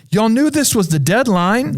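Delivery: high-pitched disbelieving question; acted-out incredulity, voice lifted; incredulous professor. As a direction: question them in retold disbelief. Professor confronts late students.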